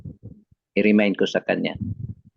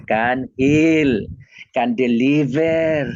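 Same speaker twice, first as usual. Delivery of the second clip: slow, stretched preaching emphasis; emphatic proclamation; 2 semitones higher, much slower than usual. Real, not acted. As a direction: draw out each act of power. Preacher proclaiming the power of Jesus' name. (mabagal at madiing pangangaral)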